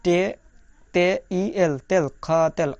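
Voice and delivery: "তেল, খ, তেল" built up in separate pieces; sounded out. Shown as a demonstration. Teacher showing learners how খতিল is pronounced, sounded out piece by piece. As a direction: spell out তে, ই, এল, then join as "তেল". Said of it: "এই খতিল শব্দটার উচ্চারণ".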